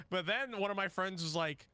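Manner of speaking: usual speaking voice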